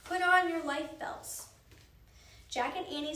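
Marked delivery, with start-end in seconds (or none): 0.0-1.5 loud slow call. Acted. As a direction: call it down the hall. Crew calling out to passengers.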